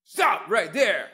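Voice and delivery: high, slow, loud "Stop right there"; barked police order. Shown as a demonstration. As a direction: command them to freeze. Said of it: "bark an order".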